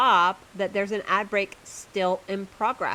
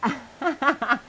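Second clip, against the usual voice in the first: laughing; 6 semitones higher, 4 dB louder than usual. Real, not acted. Laughs at own challenge.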